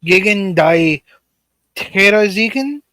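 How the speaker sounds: reading names in a fake German accent; mock German accent, drawn out; pseudo German accent. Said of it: "your pseudo German accent wasn't too bad".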